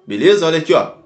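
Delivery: ordinary talk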